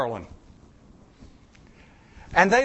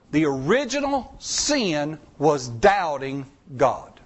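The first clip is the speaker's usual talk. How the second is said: ordinary talk, nothing marked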